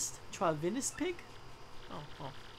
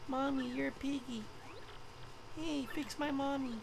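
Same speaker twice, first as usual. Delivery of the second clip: put-on high, soft little kid voice; a small child, quiet and plaintive; 6 semitones higher, 7 dB quieter than usual. Child tells pig mother to be fixed, soft childlike voice.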